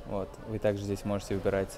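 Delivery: ordinary delivery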